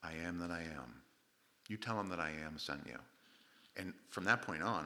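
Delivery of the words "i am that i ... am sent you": low, quiet, solemn answer; hushed, lowered voice of God; God speaking. Acted. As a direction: answer as God, softly and with authority. God answers Moses from the bush.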